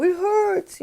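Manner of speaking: put-on high, pained little child voice; hurt child. Parent voicing a child who got hurt, high and pained. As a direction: whimper it like a small hurt kid. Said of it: "if he says".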